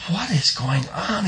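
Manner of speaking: slow, high scared question; frightened. Acted. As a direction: ask it in frightened wonder. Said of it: "he thought".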